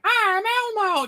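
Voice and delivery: very high, slow, squeaky puppet voice; Elmo voice. Retold or imitated. Elmo running down the hallway, squeaky high greeting. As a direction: chirp it like the little red puppet. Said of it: "bloody Elmo going".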